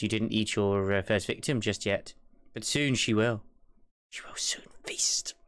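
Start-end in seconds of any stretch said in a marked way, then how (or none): 4.1-5.3 slow creepy whisper. Pretend. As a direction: whisper it like a threat. Creepy comment on child.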